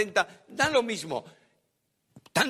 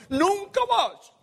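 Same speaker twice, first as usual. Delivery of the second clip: high, louder, slow declaration; dramatic protest; 13 semitones higher, 4 dB louder, slower than usual. Retold or imitated. Preacher voicing Jeremiah refusing God, high and slow. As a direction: throw it out like a stubborn vow.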